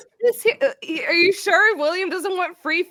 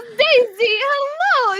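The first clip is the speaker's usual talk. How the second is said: high, cooing hello; delighted greeting; 8 semitones higher, 5 dB louder, much slower than usual. Acted. Grendel greeting her old donkey Daisy, delighted. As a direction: greet a beloved pet with glee. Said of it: "She goes".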